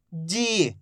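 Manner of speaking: "जी" with a long, held ee; drawn-out long vowel. Shown as a demonstration. Teacher modelling for learners to repeat, "जी" drawn out. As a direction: stretch the vowel in "जी". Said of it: "खींच खींच के पढ़ेंगे".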